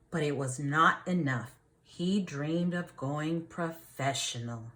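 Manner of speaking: lively storybook reading for a class; reading to children. A narrator's reading. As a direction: read it like a teacher reading a picture book to the class. Reads picture book aloud.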